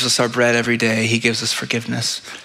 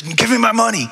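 demanding servant; 9 semitones higher than usual. Acted. Servant chokes his debtor.

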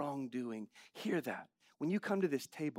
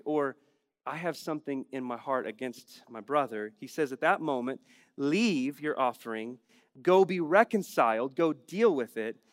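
loud, raised preaching voice; preaching at full force; 3 semitones higher, 9 dB louder than usual. Acted. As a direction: drive the point home to the congregation.

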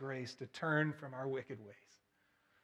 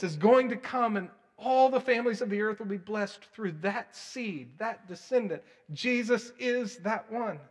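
loud, raised, driving preacher voice; full-force preaching; 6 semitones higher, 11 dB louder than usual. Acted. Preacher driving the promise to Abraham home, loudly. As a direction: preach it out to the hall.

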